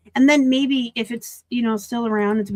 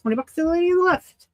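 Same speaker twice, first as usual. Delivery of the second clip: high, loud auctioneer call; 8 semitones higher, 4 dB louder, faster than usual. Pretend. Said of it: "Feel like an auctioneer".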